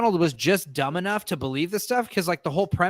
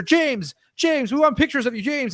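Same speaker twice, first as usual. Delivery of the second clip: mock paparazzi calling; 8 semitones higher than usual.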